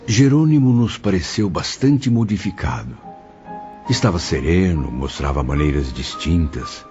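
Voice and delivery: ordinary talk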